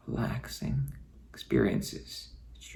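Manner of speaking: slow, soft whisper; slow hypnotic whisper. Acted. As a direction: hush it down slowly. Whispered deepening cue.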